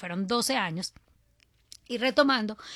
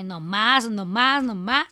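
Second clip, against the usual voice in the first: louder, insistent no más; a firm, louder refusal; 7 dB louder, slower than usual. Retold or imitated. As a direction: put your foot down, no more. Retold decision after loss.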